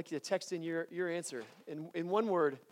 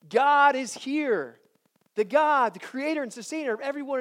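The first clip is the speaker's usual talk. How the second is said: proclamation; 7 semitones higher, 9 dB louder than usual. Real, not acted. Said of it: "proclaiming climax".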